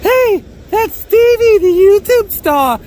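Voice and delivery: put-on high, loud, slow girly fan voice; swooning girls. Imagined girls spotting him outside the bagel store, high and gushing. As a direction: gush like a starstruck girl. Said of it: "girls are gonna stop being like".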